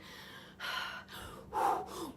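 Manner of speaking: a heavy breathy sigh; long defeated sigh. Retold or imitated. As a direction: let it all out in a sigh. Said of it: "And I'm like".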